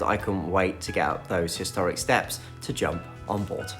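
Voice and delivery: ordinary talk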